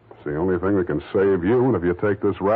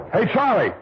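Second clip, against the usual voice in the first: sharp call, higher and louder; shout; 3 dB louder than usual. Acted. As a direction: yell his name to stop him. Calling out to Charlie in fight.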